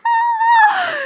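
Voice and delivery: very high, loud mock wail; hammed-up high infant scream; baby's panicked scream. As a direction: shriek like a toddler who's just tumbled. Baby freaking out after fall.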